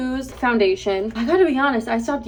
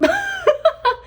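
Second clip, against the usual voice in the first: laugh; 4 dB louder than usual. Real, not acted. Waiting an hour with strips in.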